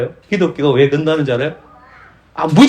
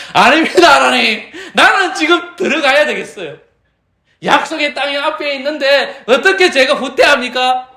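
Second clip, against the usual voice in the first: mocking Israelites; 10 semitones higher than usual. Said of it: "이스라엘 백성은 뭐라고 합니까".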